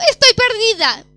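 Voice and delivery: high, loud broken cry of "Papá"; almost crying. Retold or imitated. Lost driver phoning her dad, almost crying. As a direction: call out "Papá" on the edge of tears. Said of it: "casi llorando".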